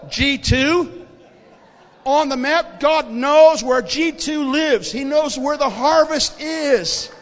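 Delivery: full-force preaching. Real, not acted. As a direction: proclaim it to the whole congregation with fervour.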